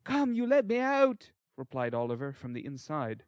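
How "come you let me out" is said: put-on high, loud child's voice; small boy calling out through a door; defiant boy. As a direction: demand release in a high-pitched boyish shout. Locked-in boy demands release.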